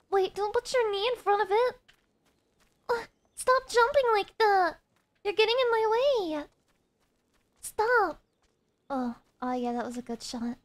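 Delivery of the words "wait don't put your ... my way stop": loud, high, exasperated shouting; annoyed yelling. Real, not acted. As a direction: yell at them to stop. Yelling at jumping character.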